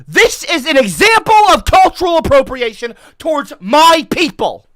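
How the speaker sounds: loud, high, hammed-up fury; mock outraged rant. Ginger vlogger rants at the internet, hammed-up loud fury. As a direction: rant like a wronged activist, over the top.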